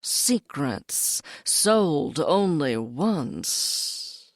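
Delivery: slow, low secretive voice; mysterious voice. Acted. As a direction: draw it out like a hidden seller. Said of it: "the voice said again".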